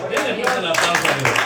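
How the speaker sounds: rally the room fast; excited call for applause. Real, not acted. Preacher calls for applause.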